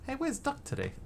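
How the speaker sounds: high, light retelling of the question; retold question. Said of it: "I was just saying".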